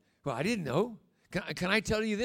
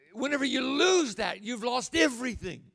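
ordinary talk

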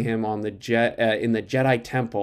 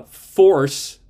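"force" punched louder; emphasis on force; 6 semitones higher, 9 dB louder than usual. Real, not acted. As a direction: lean hard on the word "force", louder than the rest. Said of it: "emphasis on the word force".

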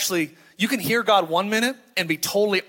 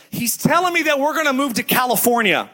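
put-on higher-pitched child breaking big news; excited little girl; 5 semitones higher, 3 dB louder than usual. Five-year-old tells Daddy they're moving, imitated excited child. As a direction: blurt it out like a thrilled kid, imitated. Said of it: "and she goes".

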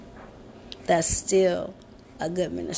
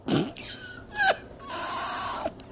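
high cackling laugh. Real, not acted. Laugh after party line.

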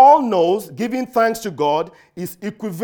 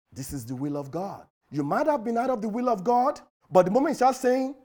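ordinary talk

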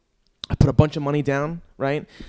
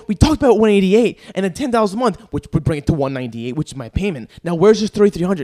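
heated demand; 5 semitones higher, 6 dB louder than usual. Retold or imitated. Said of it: "really Tony Soprano".

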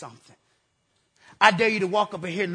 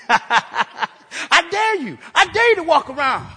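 loud, higher preacher's cry; preaching shout; 5 semitones higher, 6 dB louder, slower than usual. Real, not acted. Preacher daring the congregation, loud rising shout. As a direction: call it out to the congregation.

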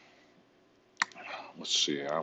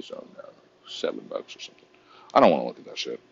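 ordinary talk, nothing marked

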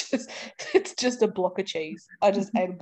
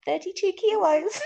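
smug friend voice; 10 semitones higher than usual. Retold or imitated. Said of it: "being like".

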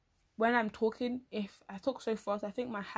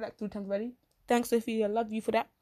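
ordinary talk

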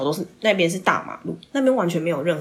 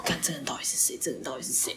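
hushed, much softer whisper; whispering, baffled by the date; whispered inner thought; 3 semitones lower, 9 dB quieter than usual. Retold or imitated. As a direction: mutter it under your breath, baffled. Inner thought while chatting.

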